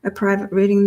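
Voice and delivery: ordinary talk, nothing marked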